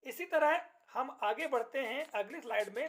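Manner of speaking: ordinary talk, nothing marked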